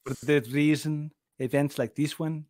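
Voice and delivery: a soft, quiet Mexican accent on the German quote; mock Mexican accent. Shown as a demonstration. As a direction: lightly imitate a Mexican accent. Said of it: "that sounds more like Mexican".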